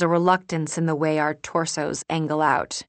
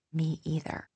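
barely voiced, much softer; just mouth it, almost no sound; 7 dB quieter than usual. Narrator silently mouthing to Molly, barely audible. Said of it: "I nod and mouth".

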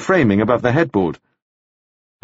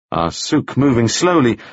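ordinary delivery, nothing marked

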